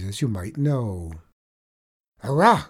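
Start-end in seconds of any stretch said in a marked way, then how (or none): 2.2-2.7 sudden loud Irish cry; great shout. Acted. Tradesman catching the leprechaun, loud shout. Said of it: "cries the tradesman with a great shout".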